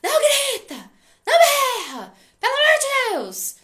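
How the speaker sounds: shouted high and very loud, laughing through it; a parent yelling at the kid, hammed up with a laugh; mock parent yelling. Parent yelling at a noisy child, mock shout with laughter.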